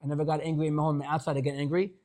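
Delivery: mock wrong reading. Shown as a demonstration. Rabbi mocking the wrong interpretation, quick and dismissive. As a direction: rattle it off as the mistaken reading. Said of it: "that's not what it means".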